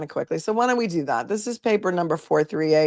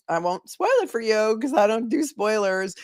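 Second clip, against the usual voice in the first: ordinary talk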